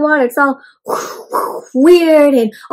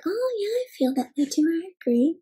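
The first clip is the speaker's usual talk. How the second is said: very soft imitation of listeners agreeing; hushed agreement; 2 semitones higher, 12 dB quieter than usual. Kids agreeing with Cookie's poem, acted very softly. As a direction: say it under your breath, like kids nodding along.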